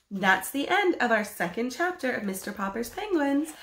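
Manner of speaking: ordinary delivery, nothing marked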